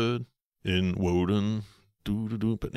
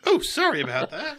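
high apologetic voice; 13 semitones higher than usual. Retold or imitated. Voicing Hazel's apology.

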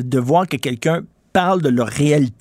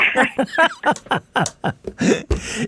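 high-pitched burst of laughter; a real high laugh at the host's joke; 9 semitones higher than usual. Real, not acted.

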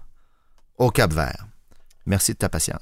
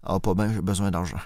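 softer, hammed-up plea for money; mock despair; 5 semitones lower, 5 dB quieter than usual. Begging Fred for a loan, mock desperate and softer. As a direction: play a beggar down on his luck, softly. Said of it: "le désespoir dans la voix".

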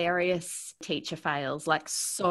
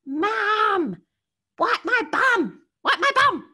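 put-on much higher, louder child's yell, slow; toddler yelling; 7 dB louder, slower than usual. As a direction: call out like a three-year-old demanding help. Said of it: "He called out at the top of his lungs".

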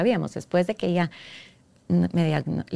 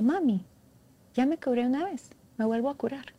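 put-on smiling child; 5 semitones higher, 4 dB quieter than usual. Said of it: "Pero así con la sonrisa".

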